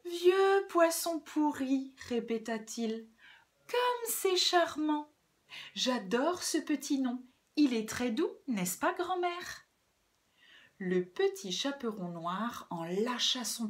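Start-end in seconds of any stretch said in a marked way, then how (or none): 0.1-1.9 high, slow, honeyed repeat; syrupy sweetness, pitched up; sweet gentle voice. Acted. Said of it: "lui prit sa voix douce". 3.7-9.6 high, cooing delight; honeyed, put-on niceness; sweet gentle voice. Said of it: "voix douce".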